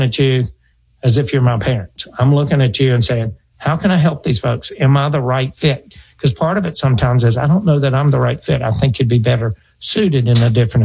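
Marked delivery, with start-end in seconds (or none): none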